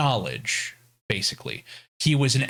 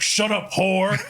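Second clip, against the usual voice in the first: higher, curt brush-off; mock snap; 7 semitones higher, slower than usual. Kratos cutting Freya off, mock snap. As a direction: bite it off. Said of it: "and he's like".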